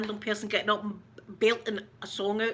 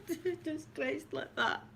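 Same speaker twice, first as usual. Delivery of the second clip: mock sobbing; 6 semitones higher, 8 dB quieter, slower than usual. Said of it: "I'm sitting with my hankies".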